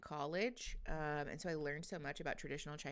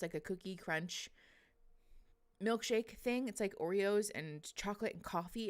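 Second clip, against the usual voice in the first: ordinary talk, nothing marked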